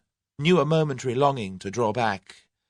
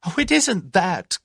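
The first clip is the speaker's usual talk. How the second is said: hysterical laugh; 11 semitones higher, 8 dB louder than usual. Acted. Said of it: "gasped Tuppence with an almost hysterical laugh".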